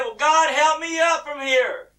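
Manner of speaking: high, loud, drawn-out holler; shouted plea. Acted. Man on the cliff begging God, shouting high. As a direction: yell for rescue from below.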